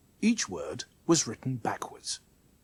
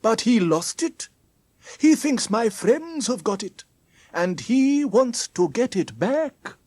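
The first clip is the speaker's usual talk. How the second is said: higher, louder character voice; story character explaining; 8 semitones higher, 5 dB louder than usual. Acted. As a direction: explain it in a raised, animated storybook voice.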